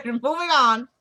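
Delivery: amused, drawn-out move to the next topic; laughing. Real, not acted. Laughs while changing the subject.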